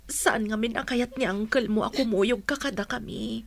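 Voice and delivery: normal talking voice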